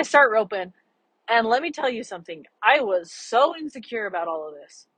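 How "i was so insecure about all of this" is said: normal talking voice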